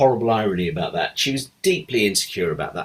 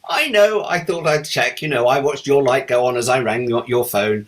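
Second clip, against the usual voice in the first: high, louder teasing imitation of Liz; playful Liz; 6 semitones higher, 5 dB louder than usual. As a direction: gleefully admit you were watching.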